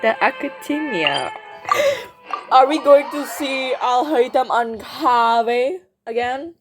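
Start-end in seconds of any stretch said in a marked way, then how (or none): none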